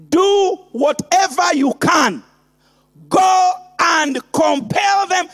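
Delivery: full-force preaching. Acted. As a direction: hammer each line home. Building sermon refrain, shouting.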